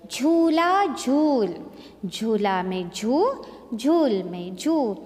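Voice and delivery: drawn-out झू; slow, stressed झू. Shown as a demonstration. Teacher stresses झू to show the long ऊ. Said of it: "दोनों तरफ बड़े ऊ की मात्रा".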